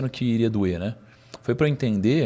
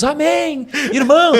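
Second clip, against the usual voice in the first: much higher, louder fervent amen; churchgoer amen; 7 dB louder, slower than usual. Retold or imitated. Imitating crowd shouting amen at the talk, high and loud. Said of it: "comecei a ouvir uns".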